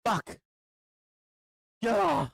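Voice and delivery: high, pained cursing; real exasperation; frustrated outburst. Real, not acted. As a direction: curse and cry out at your luck. Frustrated outburst over missed trade.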